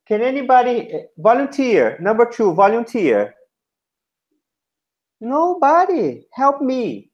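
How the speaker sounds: high-pitched classroom appeal; coaxing for volunteers. Shown as a demonstration. Teacher asking beginners for a volunteer, coaxing.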